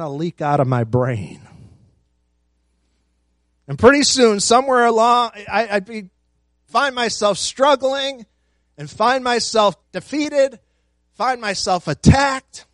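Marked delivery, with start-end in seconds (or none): 3.7-12.7 louder and higher, building up; building intensity. Real, not acted. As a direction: preach it rising to a peak.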